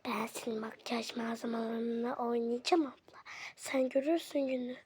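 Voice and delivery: low, hushed scheming; sneaky whisper. Acted. Child plotting to play with big sister's makeup, sneaky whisper. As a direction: whisper it like a secret plan. Sibling's secret threat to sister.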